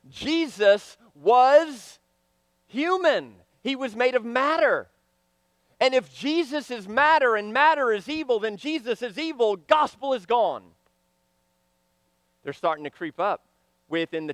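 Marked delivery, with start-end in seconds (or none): none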